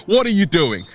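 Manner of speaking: ordinary talk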